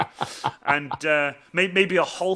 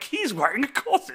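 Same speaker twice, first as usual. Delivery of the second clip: high, thrilled voice, higher; excited fan; 9 semitones higher than usual. Retold or imitated. Fan spotting the comedian's corset, excited high. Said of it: "and I was like".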